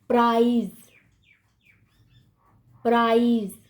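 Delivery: प्राइज ending in a buzzed z; "praaiz" each time for प्राइज; z sound. Shown as a demonstration. Teacher models an English word, प्राइज with final z. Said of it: "ज पढ़ रहे हो".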